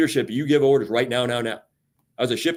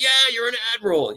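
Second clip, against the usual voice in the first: high, quick, obvious answer; matter-of-fact coworker; 10 semitones higher, faster than usual. Retold or imitated. Coworker answering the admiral, fast and high. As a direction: toss it back fast like it's obvious. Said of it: "quoted exchange".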